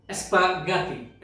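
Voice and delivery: স্পাগাতি stretched out slowly; slow Portuguese word. Shown as a demonstration. Teacher modelling স্পাগাতি slowly for learners. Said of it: "উচ্চারণটা ভালো করে উচ্চারণগুলো দেখে নেবেন".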